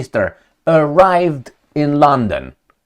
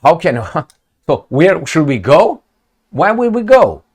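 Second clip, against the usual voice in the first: mock confused leaders; 3 semitones higher, 5 dB louder than usual. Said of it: "everybody's like".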